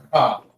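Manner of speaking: Ka said with a hard k and open a; Ka demo. Shown as a demonstration. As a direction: clear Ka, the same as before. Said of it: "just the way I just said it".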